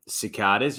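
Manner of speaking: "cicadas" as si-KAH-duhz; Australian pronunciation. Shown as a demonstration. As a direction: say "cicadas" with a long AH. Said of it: "we say cicadas here".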